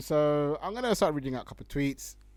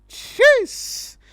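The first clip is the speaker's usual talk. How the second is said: loud, much higher burst of disbelief; shocked jeez; 16 dB louder than usual. Real, not acted. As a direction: blurt it out, stunned by the tweet. Reacting to the threat tweet.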